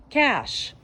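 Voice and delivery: high, sing-song call of the name; calling Cash, who hears skateboarders outside; calling the dog. Real, not acted. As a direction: get the dog's attention sweetly.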